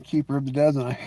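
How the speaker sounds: ordinary talk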